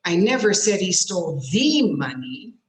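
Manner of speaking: emphatic "thee" for "the"; stress on the. Shown as a demonstration. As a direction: punch and stretch "the" before money. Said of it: "put stress on a different word".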